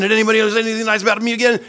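high, loud, rushed and breathless; rattled off at double speed, craving compliments; eager rush. Retold or imitated. Eagerly rechecking comments for praise.

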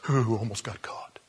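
hushed inner voice; whispered thought. Retold or imitated. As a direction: whisper it to yourself, relieved. Peter thinking to himself.

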